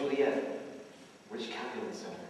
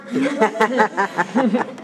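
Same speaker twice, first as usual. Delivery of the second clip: loud open laugh; hearty laugh; 8 semitones higher, 13 dB louder than usual. Real, not acted. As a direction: burst out laughing. Laughs greeting nurse.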